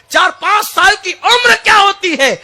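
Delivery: the question thrown out high and loud; preaching shout. Real, not acted. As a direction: hammer the question home. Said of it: "غور تو کرو".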